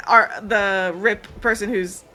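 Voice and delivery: normal talking voice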